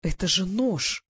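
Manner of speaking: slow, drawn-out exclamation; exclaiming. A narrator's reading. Glasha recognises a knife scar, exclaiming. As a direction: stretch it out in shocked recognition. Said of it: "воскликнула она".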